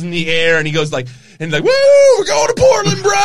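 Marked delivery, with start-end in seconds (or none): 1.6-3.1 high, loud celebratory holler; whooping shout. Retold or imitated. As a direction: whoop it up like you're taking off. Acting out wrestler's takeoff whoop.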